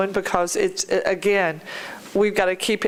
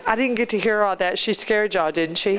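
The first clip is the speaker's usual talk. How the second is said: usual speaking voice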